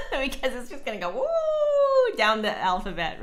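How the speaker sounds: giggly, fast words; laughing while talking. Real, not acted. Joking to chat, laughing as she talks. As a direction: talk through the laugh. Joking about the plus sign.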